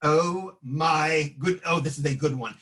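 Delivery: normal talking voice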